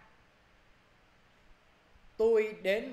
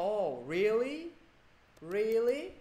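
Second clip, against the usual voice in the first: drawn-out questioning rise, louder at the end; acted surprise; 4 semitones higher, 5 dB louder, much slower than usual. Person B reacting to not being invited, acted surprise. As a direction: stretch it slowly upward in disbelief.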